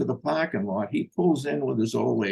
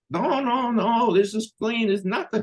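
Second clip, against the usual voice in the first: squeaky imitated denial; high mock pleading; 10 semitones higher than usual. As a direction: voice jumps way up, pleading innocence. The buyer pleading innocence.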